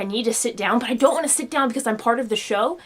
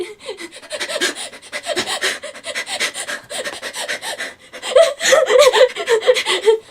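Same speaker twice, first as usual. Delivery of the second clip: rapid high sobbing gasps, swelling loud; hyperventilating demo; 13 semitones higher, 6 dB louder than usual. Shown as a demonstration. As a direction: act out hyperventilating while crying, building. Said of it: "I also start to hyperventilate".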